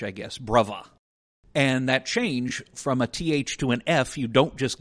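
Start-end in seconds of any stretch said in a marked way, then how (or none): 0.4-0.9 bruvva said with v for th; Cockney bruvva. Shown as a demonstration. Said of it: "B U R V V A H".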